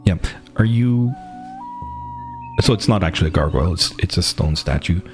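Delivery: ordinary talk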